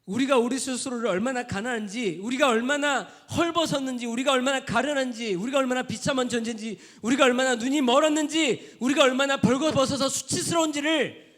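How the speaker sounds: high, loud, ringing on every line; full-force preaching shout; fervent preaching shout. Acted. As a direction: cry it out to the congregation. Preaching climax.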